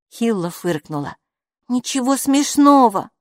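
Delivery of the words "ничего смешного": high, louder reproach; reproachful little character. A narrator's reading. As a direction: scold mildly in a raised pitch. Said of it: "укоризненно проговорила Алиса".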